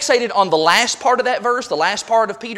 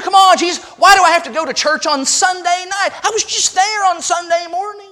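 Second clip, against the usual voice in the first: high-pitched acted protest; whining; 9 semitones higher than usual. Churchgoer objecting to Sunday night church, whining. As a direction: whine it high, like haggling your way out. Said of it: "we want to whine".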